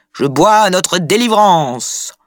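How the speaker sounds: high, slow, drawn-out proclamation; triumphant toast. A narrator's reading. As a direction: raise the glass and proclaim it grandly. Loiseau rises to give a toast.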